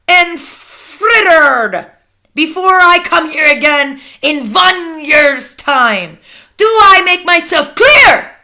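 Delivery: loud tyrant witch, stretching key words; shrieked orders. A narrator's reading. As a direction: proclaim brutal orders at full volume, slowly. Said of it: "shrieked the Grand High Witch".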